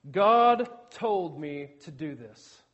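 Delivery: slow, low, loud opening on God; mock solemn claim. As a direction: imitate a weighty divine mandate. Quotes the rogue missionary's excuse.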